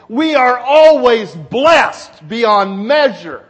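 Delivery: loud, slow, weighty sermon delivery; forceful preaching. Real, not acted. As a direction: proclaim it to the congregation.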